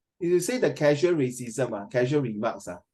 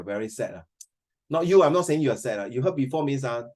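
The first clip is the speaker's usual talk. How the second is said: ordinary delivery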